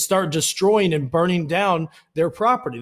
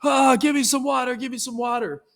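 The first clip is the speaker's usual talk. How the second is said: high, hammed-up wail for water; pitched-up mock sob, fading at the end; mock crying plea; 7 semitones higher than usual. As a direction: imitate a kid sobbing from tear gas. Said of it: "screaming and crying".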